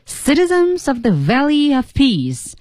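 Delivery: "citizens of the Valley of Peace" high, with strong and weak beats; 有轻有重. Shown as a demonstration. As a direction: proclaim "citizens of the Valley of Peace" in stressed English rhythm. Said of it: "一定要感受英文的节奏". (高声调读“citizens of the Valley of Peace”，有轻有重)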